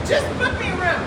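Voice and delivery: emphatic, exasperated insistence. Retold or imitated. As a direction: press the request hard without yelling. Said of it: "And I'm not yelling at this point".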